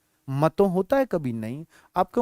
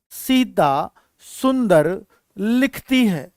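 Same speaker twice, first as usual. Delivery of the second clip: word by word, very slowly; slow dictation; slower than usual. Shown as a demonstration. Teacher writing an example for students, slow dictation. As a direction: dictate it with gaps between words.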